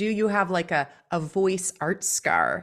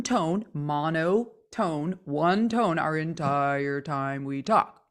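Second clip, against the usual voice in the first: "monotone, one tone" drawn out slowly on one low note; 4 semitones lower, much slower than usual. Shown as a demonstration. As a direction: drone "one tone our entire time" low and slow. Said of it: "just stuck on one".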